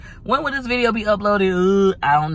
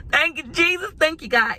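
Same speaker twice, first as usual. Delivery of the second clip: joyful thanks; 10 semitones higher than usual. Real, not acted. Voiced thanks after whisper.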